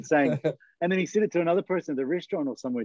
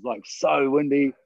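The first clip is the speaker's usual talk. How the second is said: normal talking voice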